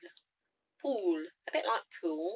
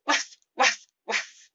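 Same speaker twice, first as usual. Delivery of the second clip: loud breathy barking waf; dog barks; 6 semitones higher, 9 dB louder, slower than usual. Shown as a demonstration. Teacher barking again like a dog. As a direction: bark it out breathily.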